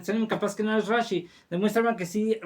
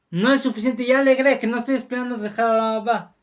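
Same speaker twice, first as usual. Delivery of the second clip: fervent answer; 4 semitones higher, 6 dB louder, faster than usual. Acted. Carnicero responde con fervor.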